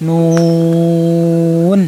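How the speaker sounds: নুন held very long on one level note; sustained, chanted নুন with a humming end; rounded long oo. Shown as a demonstration. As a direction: round the lips through the stretched oo of নুন. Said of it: "পেশের সময় শুধু গুডুল হবে".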